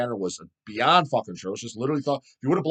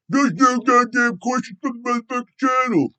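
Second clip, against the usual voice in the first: higher, louder mocking complainer voice; mock whiny viewer; 11 semitones higher, 5 dB louder than usual. Mocking a viewer who says there are no channel questions, sneering. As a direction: sneer it like a griping commenter.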